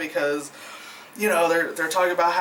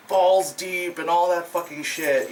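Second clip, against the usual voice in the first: usual speaking voice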